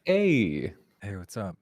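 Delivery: low, sleepy just-woke-up voice; morning voice. Real, not acted. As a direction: say it like you just rolled out of bed. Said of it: "What is this morning voice shit?".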